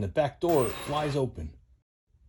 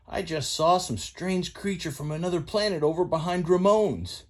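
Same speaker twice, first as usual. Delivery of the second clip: higher, twangy country tow truck; lightly pitched-up country boy voice; folksy tow truck Mater; 6 semitones higher than usual. Acted. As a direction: play Mater the rusty tow truck, eager to spill news.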